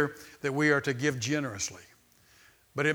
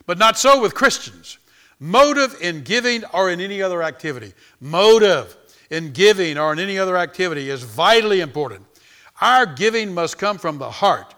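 full-force preaching; 2 semitones higher, 10 dB louder than usual. Acted. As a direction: preach it to the whole church.

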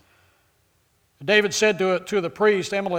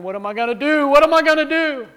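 mock whining, rising higher and louder; imitating a crying, whining Godfather singer; 7 semitones higher, 6 dB louder than usual. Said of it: "he's crying and he's whining".

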